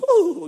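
very high loud Pillsbury Doughboy coo; Doughboy giggle. Retold or imitated. Imitating the poked Pillsbury Doughboy, high squealing coo. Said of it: "waiting for her to go".

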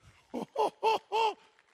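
loud high burst of laughter; excited shriek of laughter; delighted laugh. Real, not acted. Laughing at the healing.

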